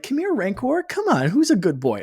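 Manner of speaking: put-on high sing-song baby talk to an animal; pet talk voice. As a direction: coo at it like a puppy. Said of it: "and just be like".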